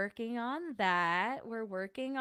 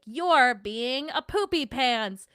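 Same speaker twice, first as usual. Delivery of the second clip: slow, louder mock telling-off; mock scolding; 5 semitones higher, 9 dB louder, slower than usual. Imagining scolding someone, a slow mock telling-off. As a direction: pretend to scold like a hero. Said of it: "get, like, angry and be like".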